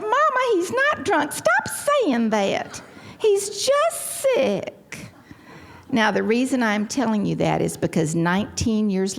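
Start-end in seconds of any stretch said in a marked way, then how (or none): 0.0-5.1 very high, hammed-up protesting wail; mock-distraught denial, slowing right down; indignant denial. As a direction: plead with Mama, stretching the last words out. Acted quarrel, escalating denial to mother.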